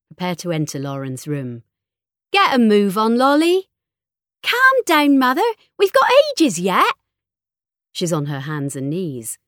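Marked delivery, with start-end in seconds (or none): 2.3-3.7 loud, raised call to get moving; impatient hurrying. A narrator's reading. Mother hurrying her daughter before the drive, impatient and loud. 4.4-6.9 put-on high, loud teenage protest; exasperated daughter. A narrator's reading. Daughter telling her mother to calm down, high exasperated voice.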